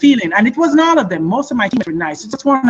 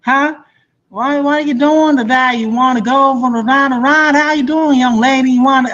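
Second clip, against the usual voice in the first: put-on Southern accent; 4 semitones higher than usual. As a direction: play the cowboy asking her out for a ride. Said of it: "I'm like".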